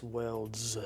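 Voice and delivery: "worlds" drawn out, final "s" buzzing; stress on plural ending. Shown as a demonstration. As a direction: lean slowly on the "s" of "worlds".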